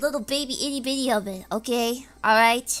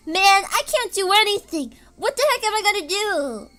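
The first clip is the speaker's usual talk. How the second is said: ordinary talk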